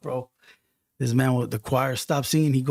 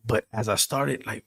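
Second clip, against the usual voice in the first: ordinary talk, nothing marked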